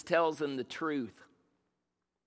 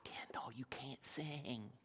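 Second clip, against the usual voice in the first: soft unvoiced whisper of the wife's verdict; 4 semitones lower, 18 dB quieter, slower than usual. Wife gently telling him he cannot sing, whispered. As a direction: breathe it out like a blunt secret. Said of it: "she's like".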